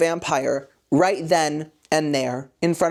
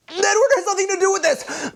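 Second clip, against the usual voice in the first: squeaky, much higher mock-dramatic Bella; mock Bella protest; 13 semitones higher than usual. Bella snapping at James about Edward, in a high squeaky mock voice. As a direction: whine it like an offended teen heroine. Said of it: "and she's like".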